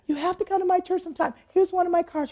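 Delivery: quick, high-pitched retold eagerness; eager invitation. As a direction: mimic her chirping out an invite.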